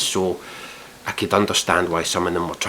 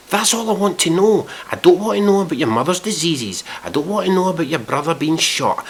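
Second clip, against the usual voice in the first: exasperated, high-pitched re-enacted rant; shouting at monitor; 7 semitones higher than usual. Viewer shouting at the monitor over sob stories, exasperated. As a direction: hammer out each demand, fed up. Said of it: "I'm shouting at my fucking monitor".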